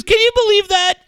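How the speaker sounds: very high, loud incredulous cry; imitated outraged disbelief. Dad quoting his indignant son, loud mock outrage. Retelling son's outrage at mom.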